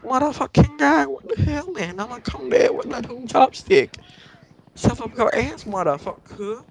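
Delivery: cursing out. Retold or imitated. Speaker yelling at the Walmart manager on the phone, furious. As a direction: go off on him with mock threats.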